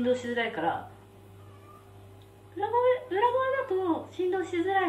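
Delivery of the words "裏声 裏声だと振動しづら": high, light head voice; falsetto. Shown as a demonstration. Eater showing a voice that hurts less, falsetto. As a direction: switch up into falsetto to spare the mouth. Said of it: "なんかこういう声で喋ってた方が".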